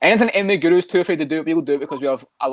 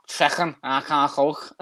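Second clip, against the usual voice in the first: slow, deliberately bad Scouse; botched Scouse with throaty k sounds; Kelvin Gastelum; much slower than usual. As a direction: imitate a failed Liverpool accent. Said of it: "video of Kelvin Gastelum saying".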